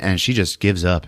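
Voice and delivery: usual speaking voice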